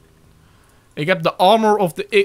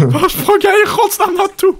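laughing disbelief; 6 dB louder than usual. Real, not acted. Friend's wild jump, laughing.

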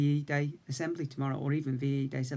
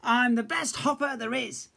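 higher proud little bear; boastful cub; 10 semitones higher, 4 dB louder than usual. A narrator's reading. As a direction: brag to himself. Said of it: "Little Bear said".

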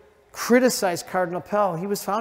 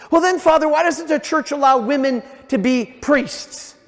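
loud, higher challenging voice; a loud, higher challenger objects to the priest; challenging questioner; 7 semitones higher, 6 dB louder than usual. Retold or imitated. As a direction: throw the objection back loudly and higher.

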